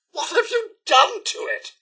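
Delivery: high-pitched, aghast question; appalled. Acted. Character shown a ruined item, appalled. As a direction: react in horrified disbelief.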